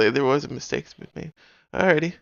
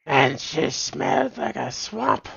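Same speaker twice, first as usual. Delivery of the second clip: higher, louder witch voice breaking into laughter; bad witch voice; 7 semitones higher, 5 dB louder, slower than usual. Streamer to chat, straining a bad witch voice. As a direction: push the witch voice until it cracks up. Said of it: "this voice is really bad".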